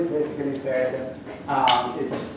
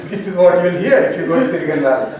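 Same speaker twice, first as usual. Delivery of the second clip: laughing; 3 semitones higher, 7 dB louder, faster than usual. Real, not acted. Joking about his accent.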